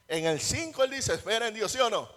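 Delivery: preaching. Acted. Preaching at full force.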